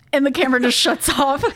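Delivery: normal talking voice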